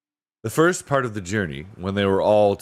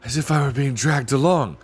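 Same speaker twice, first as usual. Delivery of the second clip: breathless; 5 semitones higher than usual. A narrator's reading. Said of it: "in a breathless voice".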